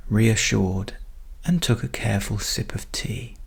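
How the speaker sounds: usual speaking voice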